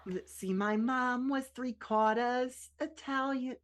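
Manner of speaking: not-quite-Boston accent, drawn out; attempted Boston accent. Shown as a demonstration. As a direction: have a rough go at Boston, dropping the r in quarters. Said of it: "just learn a Boston accent".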